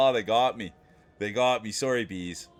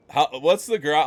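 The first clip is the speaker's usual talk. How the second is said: ordinary talk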